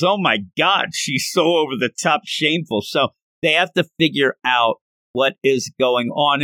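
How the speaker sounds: ordinary talk, nothing marked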